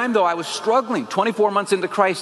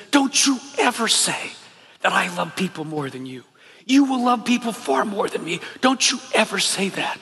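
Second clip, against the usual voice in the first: put-on a touch of an older man's tearful, pleading rebuke; 2 semitones higher than usual. Chaplain rebuking the student through tears, mildly acted. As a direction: voice the weeping chaplain gripping his shoulders.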